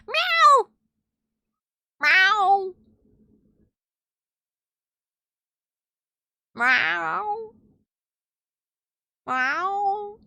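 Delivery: high, loud meow mimicking her cat. Answers her meowing cat with loud high meows. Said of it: "like, mimic her".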